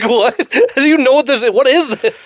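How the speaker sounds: high, laughing mock bewilderment; baffled disbelief. Retelling a friend's reaction to the song, mock baffled.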